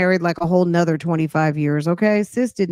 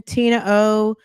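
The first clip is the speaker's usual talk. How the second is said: ordinary delivery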